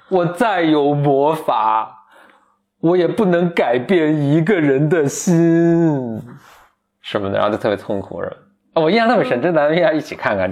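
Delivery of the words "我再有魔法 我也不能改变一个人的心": a lightly voiced demon, slow and sorrowful; demon's lament. Retelling the cartoon demon to a co-host, slow mock lament. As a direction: draw out the demon's hopeless words slowly. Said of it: "我刚刚就学的还挺惟妙惟肖的".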